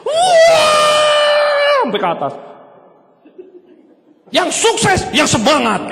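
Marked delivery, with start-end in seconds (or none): 0.1-1.8 high screaming wah; mock MC shriek. MC shrieking at the seminar crowd, loud high mock shriek. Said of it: "M C ... jerit". 4.3-5.9 mock MC yell.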